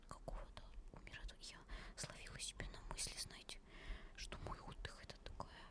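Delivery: ordinary talk, nothing marked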